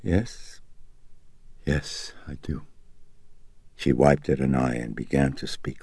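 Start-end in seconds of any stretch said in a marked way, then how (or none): 0.0-2.6 faint, halting assent; weak. A narrator's reading. Chris agreeing to tell more, weakly. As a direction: answer weakly, with a gap after the first word. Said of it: "and then, weakly, she said".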